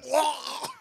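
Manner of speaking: short mock yell; mock shout. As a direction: yelp as if in pain. Robot cooker malfunction scene.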